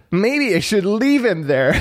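high voice breaking into giggles; laughing puppet boy. A narrator's reading. Pinocchio teasing about the tangled kitten, laughing.

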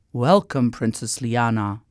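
low, courtly wizard's greeting; low-pitched kindly magician; welcoming magician. A narrator's reading.

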